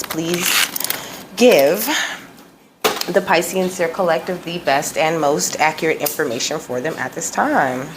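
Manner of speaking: calling in the guides, unhurried and reverent; spiritual invocation. Acted.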